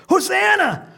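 high, loud jubilant cheer; children shouting. Acted. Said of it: "the children shouting in the temple courts".

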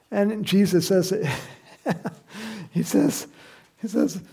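ordinary talk, nothing marked

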